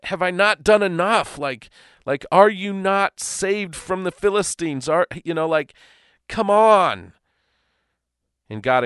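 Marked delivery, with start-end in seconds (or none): 0.0-7.1 high, loud frustrated pleading; exasperated Samuel. Retold or imitated. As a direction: plead like a fed-up prophet. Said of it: "I can just imagine Samuel like".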